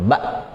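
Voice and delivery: "ba" cut short by a glottal stop; slightly pressed stop. Shown as a demonstration. Teacher confirming the reading for learners, ba with pressed ending. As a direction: lightly press the end of "ba". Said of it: "Agak ditekan sedikit".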